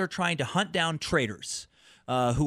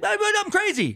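high, whiny begging squeal; mock pleading; 3 dB louder than usual. Con man to the court, high mock plea of insanity. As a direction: beg like a con man faking madness. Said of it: "he, he begs".

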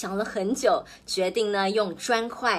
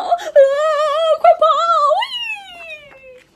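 panicked cry; 8 dB louder, slower than usual. A narrator's reading. Eldest pig flees in panic.

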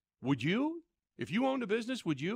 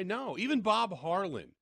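ordinary talk, nothing marked

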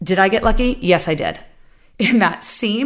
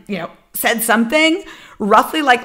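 ordinary delivery

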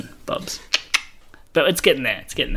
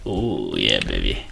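sultry; 3 dB quieter than usual. Pretend. Mock seductive response to erotica.

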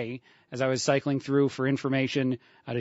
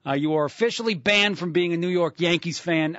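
mock-outraged Brooklyn accent, higher and a bit louder; 4 semitones higher, 4 dB louder than usual. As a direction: play a New York pizza snob banning the listener, in Brooklyn accent. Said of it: "All in a Brooklyn accent".

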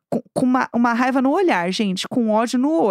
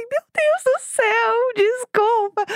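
mock panicked apology; 8 semitones higher, much slower than usual. Retold panic as man scolds her.

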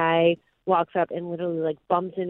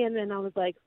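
usual speaking voice

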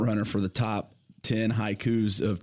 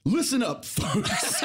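higher, hyped announcer call, laughing; wrestling promo; 9 semitones higher, much slower than usual. Shown as a demonstration. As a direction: hype up the crowd like a wrestling promo. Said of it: "Do a promo".

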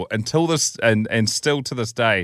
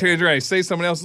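ordinary delivery

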